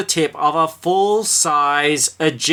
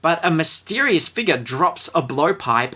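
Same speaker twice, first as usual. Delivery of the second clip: ordinary talk, nothing marked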